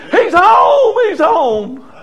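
high, full-voiced cry of joy; exultant acted father's holler; jubilant shout. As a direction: shout the good news to the whole house. Father celebrates the son's return.

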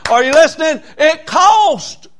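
high, loud call to the congregation; preaching shout. Real, not acted. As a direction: rouse the room with a sudden cry.

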